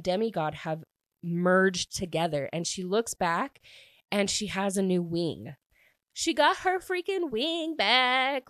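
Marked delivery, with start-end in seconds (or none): 6.1-8.5 high, drawn-out delighted exclamation; thrilled. Real, not acted. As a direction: stretch it out high, overjoyed. Thrilled at the heroine's new wing.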